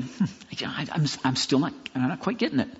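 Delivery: soft, hesitant inner thought; musing listener. Retold or imitated. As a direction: mull it over quietly to yourself. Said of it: "you might be still thinking".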